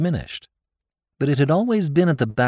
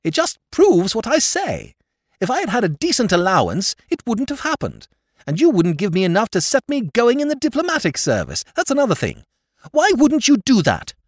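high, loud aggrieved whine; shrill complaint; 12 semitones higher, 4 dB louder than usual. Acted. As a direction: keep hammering the grievance. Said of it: "protested his lordship shrilly".

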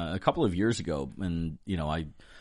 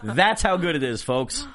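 announcer voice; 8 semitones higher, 8 dB louder than usual. Pretend. Joking ad plug voice.

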